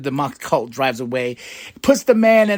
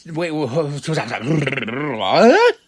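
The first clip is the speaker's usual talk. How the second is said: halting double-take ending in a yelp; stunned disbelief; much slower than usual. Real, not acted. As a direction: stumble over the shock, then burst out. Reacting to a title change.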